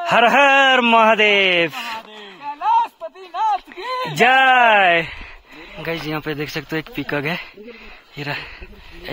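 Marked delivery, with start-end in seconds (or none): 0.1-5.1 long-drawn, high, loud cry; devotional shout. Real, not acted. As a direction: call out a rousing chant, stretching the words. Trek opens with devotional cry. (ज़ोरदार, खिंचा हुआ जयकारा)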